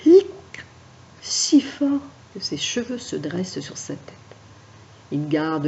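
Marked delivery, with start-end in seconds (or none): 0.1-0.2 a sharp loud hiccup. Acted. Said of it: "est pris d'un hoquet".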